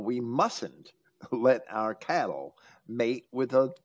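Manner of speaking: ordinary delivery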